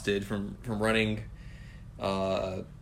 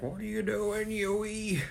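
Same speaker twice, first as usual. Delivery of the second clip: put-on high voice as an old granny; 11 semitones higher, slower than usual. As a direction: squeak it out like a little old lady. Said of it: "Who's like".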